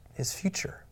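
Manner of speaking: whisper. Real, not acted. Hushed aside after laugh.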